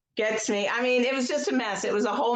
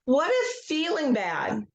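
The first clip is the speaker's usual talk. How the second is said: slow guided script with long pauses; guided tapping script. Acted. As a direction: lead the group gently, line by line.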